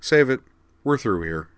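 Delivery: firm but not unpleasant; without heat. A narrator's reading. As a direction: cut them off with no anger. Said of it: "still without heat or unpleasantness".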